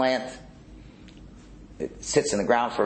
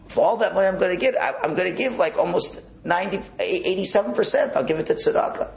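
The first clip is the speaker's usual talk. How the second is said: much higher and faster, carried away by the windfall; giddy excitement; 10 semitones higher than usual. Acted. A farmer dreaming aloud to God of a windfall, giddy. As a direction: rattle off the promises, getting giddier.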